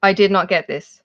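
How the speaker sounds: ordinary talk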